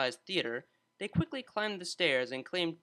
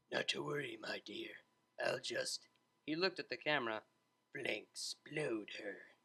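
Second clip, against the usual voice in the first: much lower, much softer suave movie hero; cheesy action hero; 3 semitones lower, 7 dB quieter than usual. A narrator's reading. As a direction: purr it low and cool, slowing at the end.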